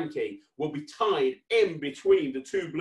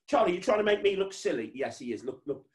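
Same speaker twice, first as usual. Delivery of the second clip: ordinary delivery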